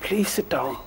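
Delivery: hushed Cork accent, softly coaxing; near-whispered plea in a light Cork accent. Shown as a demonstration. Painting teacher politely asks the reflection to settle, soft Cork accent. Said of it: "Practice your Cork accent".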